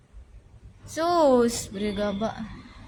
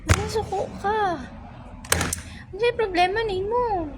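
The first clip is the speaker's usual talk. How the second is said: teasing scold; 9 semitones higher than usual. Real, not acted. Teasing a sulking viewer.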